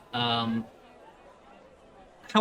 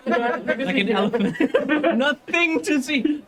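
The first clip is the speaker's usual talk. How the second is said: high, slow, laughing through it; 10 semitones higher, 4 dB louder, slower than usual. Real, not acted. GM cracking up at his own bit, laughing. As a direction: giggle the words out.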